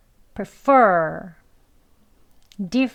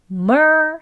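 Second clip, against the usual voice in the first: "mer" drawn out, higher and louder; stressed syllable; 7 semitones higher, 7 dB louder than usual. Shown as a demonstration. Teacher isolating the first syllable, "mer" strong and long. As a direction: hit "mer" hard and hold the r. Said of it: "er 하는 소리는 약하게".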